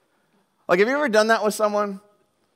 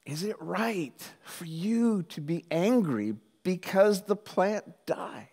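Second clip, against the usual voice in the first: very slow, soft voice of God; measured question, fading almost to a whisper; gentle questioning; 4 dB quieter, slower than usual. Acted. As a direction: probe gently, leaving space between phrases. God gently questions angry Jonah.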